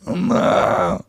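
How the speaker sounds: high breathy little no; imitating a toddler flopping down in protest; mock baby whine. Dad mimicking his son refusing to go to bed. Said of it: "collapses and goes".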